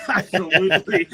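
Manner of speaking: high, loud yes through a laugh; delighted agreement. Retold or imitated. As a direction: agree heartily, laughing as you retell it. Hunters happily agree, retold with laughter.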